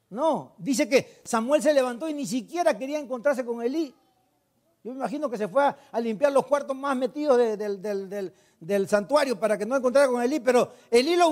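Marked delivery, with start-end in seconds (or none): none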